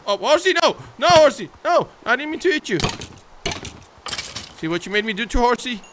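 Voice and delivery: high, loud frantic cries; panicked shriek. Real, not acted. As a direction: yell in horror at hurting the horse. Accidentally hitting own horse.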